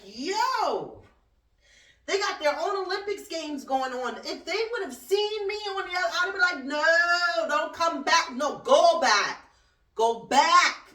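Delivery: high loud yelling, go back; mock shouted warning. To the migrants, telling them to turn back, loud high yell. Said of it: "I'm like".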